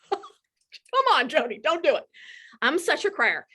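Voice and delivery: high laughing self-scold; laughing through tears. Real, not acted. Speaker laughingly begging herself not to cry in front of the team.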